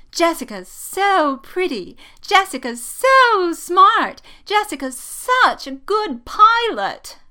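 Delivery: hammed-up high, slow, gushing impression; loud, swooning mockery with drawn-out words; Rey. A narrator's reading. Poe mimics the girl who likes Jess, gushing mockingly. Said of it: "attempting to imitate Rey's voice".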